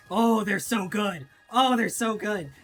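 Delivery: loud, slow, gleeful triumph; excited gloating. Real, not acted. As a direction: crow with delight over the kill. Gloating at laser defense kills.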